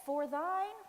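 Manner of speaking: loud, high blurt with rising end; re-enacted overconfident loud recital. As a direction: belt it out, pitched well up. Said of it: "I very loudly said".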